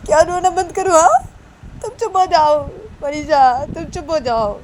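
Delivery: high-pitched aggrieved snapping; upset retort. Acted. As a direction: argue back that your pain is worse. Upset Nisha snaps back at Manisha. (दुखी होकर ऊँची आवाज़ में पलटकर जवाब)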